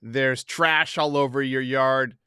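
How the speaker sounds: ordinary talk, nothing marked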